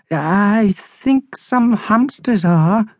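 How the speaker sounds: high, slow, wavering hamster voice; timid Harold, scared. Acted. Hamster admits being scared of birds, slow and timid. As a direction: admit fear in a small high voice.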